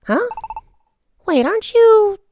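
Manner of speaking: high, startled sidekick; surprised character voice. Acted. Voicing character in game dialogue.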